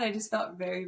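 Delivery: ordinary talk, nothing marked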